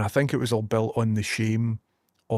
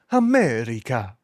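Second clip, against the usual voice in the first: drawn-out, mock-grand America; hammed-up echo; 4 dB louder than usual. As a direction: stretch it out with a playful pitch swoop. Hammed-up echo of own word.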